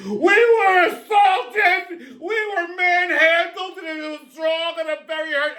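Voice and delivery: high, slow, shouted whiny Karen complaint; mocking the congresswomen as whining Karens. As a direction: wail it like an outraged entitled Karen.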